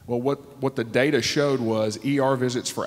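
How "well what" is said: ordinary talk, nothing marked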